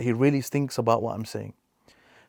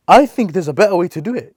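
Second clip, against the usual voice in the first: loud, stubborn complaint; loud, defiant youth insisting on his way; 7 semitones higher, 11 dB louder than usual. Retold or imitated. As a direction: blurt it out loudly, fed up. Young person complaining about father.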